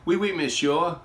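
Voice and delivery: loud, high hammed-up French accent; mock French accent. Mock French accent greeting viewers, pistol being French. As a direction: ham up a French phrase for the French pistol. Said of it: "I gotta say some French".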